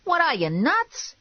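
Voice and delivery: high, drawn-out disbelief; incredulous. A narrator's reading. Passenger told to shoot, incredulous. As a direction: stretch it out, pitch way up. Vinny balks at her order.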